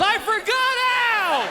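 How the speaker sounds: high, slow squeal of panic; panicked son. Retold or imitated. Scared son telling the doctor he forgot, high slow whine.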